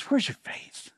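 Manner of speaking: near-whispered gentle question; hushed chiding. Retold or imitated. Jesus to his disciples after the storm, hushed chiding. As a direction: ask it softly, almost in a whisper.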